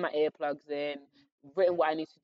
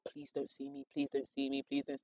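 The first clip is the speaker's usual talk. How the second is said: hushed pleading; 2 semitones lower, 9 dB quieter than usual. Retold or imitated. Hoping head of year won't spot her, hushed mock pleading. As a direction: beg under your breath, imitating dread. Silently begging not to be noticed.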